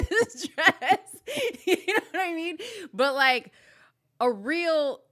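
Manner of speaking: high, loud laughing. Real, not acted. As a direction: laugh through the punchline. Laughing at her own joke.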